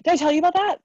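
high, loud eager question; bursting to share news; excited. Real, not acted. Excitedly asking twice.

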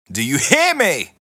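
high, full-force yell; loud, high-pitched theatrical demand; villain shout. Acted. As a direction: demand it at the top of your voice. Villain rant climax.

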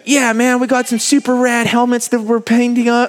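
put-on American accent, pitched high; hammed-up Californian dude, high voice; Troy Lee impression. Shown as a demonstration. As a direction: do an excitable American helmet painter. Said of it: "I can be Troy Lee".

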